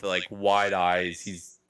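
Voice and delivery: usual speaking voice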